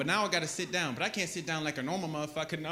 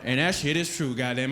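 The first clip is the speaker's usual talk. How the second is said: usual speaking voice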